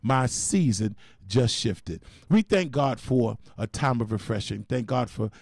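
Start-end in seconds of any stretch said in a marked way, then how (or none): 0.0-2.0 very slow, deliberate; slow affirmation. Shown as a demonstration. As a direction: say it to yourself slowly. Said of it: "Say it to yourself".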